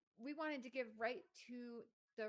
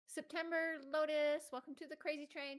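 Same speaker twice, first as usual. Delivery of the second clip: loud, raised-pitch announcement; hearty shouted welcome; member welcome shout-out; 5 semitones higher, 9 dB louder than usual. Real, not acted. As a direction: call the name out big, then welcome them aboard. Announcing a new channel member.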